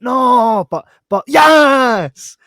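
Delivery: high, loud, drawn-out mock squeal; high excited squeal. Viewer squealing at a twist, loud and high.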